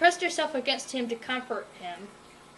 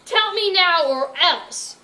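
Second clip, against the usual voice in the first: loud, slow, higher snarl; snarled threat; 6 semitones higher, 8 dB louder, slower than usual. Acted. Berkeley threatening the villain, slow loud snarl. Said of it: "Berkeley snarled".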